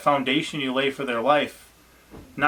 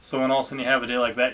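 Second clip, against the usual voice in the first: ordinary talk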